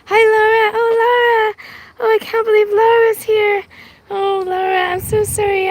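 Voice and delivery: high, delighted gushing; thrilled greeting. Real, not acted. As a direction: gush with real surprise at a friend turning up. Thrilled to spot Laura in chat.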